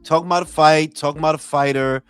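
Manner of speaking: ordinary talk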